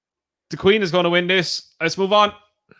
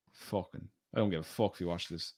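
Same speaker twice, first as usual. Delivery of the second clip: muttering; 11 semitones lower, 17 dB quieter than usual. Real, not acted. Mutters aside about colleague.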